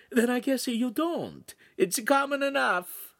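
high, slow hostess voice; high-voiced, unbothered lady; Italian signora. A narrator's reading. Signora answers the children.

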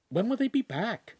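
put-on high, anxious girl's question; worried, small voice; apprehensive. A narrator's reading. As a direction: ask uneasily, pitched well up. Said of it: "Chimera asked apprehensively".

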